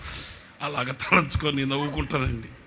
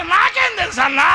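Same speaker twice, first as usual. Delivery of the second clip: higher louder helpless answer; boy's helpless shout; 8 dB louder than usual. Retold or imitated. Boy shouting he doesn't know, higher louder. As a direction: cry back that you don't know. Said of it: "అంటున్నాడు".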